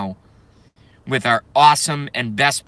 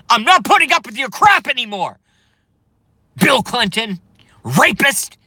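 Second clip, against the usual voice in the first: loud, high, all-out angry yelling; furious yelling; 6 semitones higher, 4 dB louder than usual. Real, not acted. Vlogger screaming accusations at Bill Clinton, furious. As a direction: yell it like you've finally snapped.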